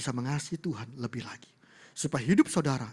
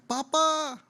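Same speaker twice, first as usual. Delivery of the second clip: high, loud calling out for Papa; child's shout; 14 semitones higher, 6 dB louder, slower than usual. Retold or imitated. Daughter spotting her father at the airport, shouting. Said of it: "seorang anak teriak".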